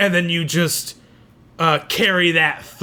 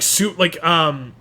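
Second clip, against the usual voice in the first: normal talking voice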